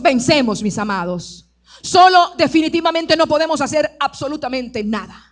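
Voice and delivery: loud, driving preacher's declaration; full-force preaching. Real, not acted. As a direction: proclaim it loudly to the congregation.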